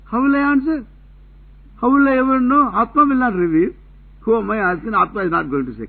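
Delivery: high, loud mock exasperation; exasperated protest. Teacher mimics the seeker stuck without an answer, exasperated protest.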